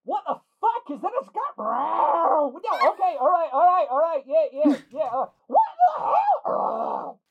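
high, loud frightened yelling; panicked shouting. Acted. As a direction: scream as the cat chases you off. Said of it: "you just hear from".

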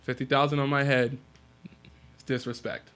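casual understated grievance; nonchalant. Acted. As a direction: drop it softly as if it were nothing. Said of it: "You said that so nonchalant".

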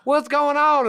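mock panicked old-timer squeak; imitating an old man caught off guard; startled old man. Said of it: "He's like".

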